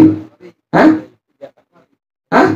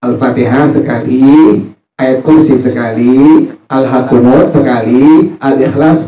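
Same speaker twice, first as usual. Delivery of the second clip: slow dictation; slower than usual. Shown as a demonstration. Teacher slowly dictating the prayer order to students. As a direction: list each surah slowly and clearly. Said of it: "Surahnya rakaat pertama".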